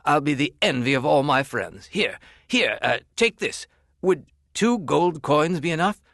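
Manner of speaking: lightly voiced, eager rich customer; eager rich man. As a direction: hand over the coins, pleased with yourself.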